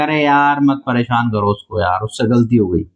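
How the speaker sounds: low-pitched, placating plea; mock pleading. Granulosa cells defending the oocyte, low pleading. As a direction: intercede for someone, pitch dropped well down.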